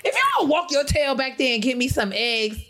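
high, indignant scold; lightly hammed-up telling-off; mock scolding. Woman scolding the grocery clerk, mock indignant.

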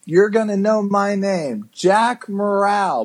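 high, cocky boast; cocky movie hero. Retold or imitated. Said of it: "And then he goes".

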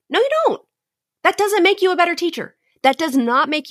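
high-pitched, insistent contradiction; emphatic rebuttal. Real, not acted. Podcast host answers a teacher's objection, emphatic rebuttal. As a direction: push back firmly, pitch jumping up high.

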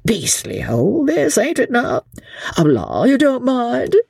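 high, whispery, apologetic dandy; apologetic fop. A narrator's reading. Sir Percy apologising to Chauvelin, high and airy. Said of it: "he added apologetically".

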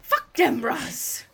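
high, loud mock Jamaican accent; shouting in a put-on Jamaican accent; Jamaican accent impression. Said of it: "impersonate this Jamaican accent".

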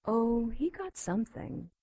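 ordinary talk

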